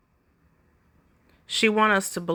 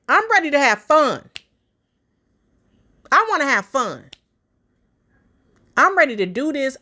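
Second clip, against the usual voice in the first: a higher, louder, giddy imitation of her; mock eager quote, higher and louder; 6 semitones higher, 4 dB louder than usual. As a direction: commentator parodies the wife's eagerness, higher and louder. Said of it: "she said".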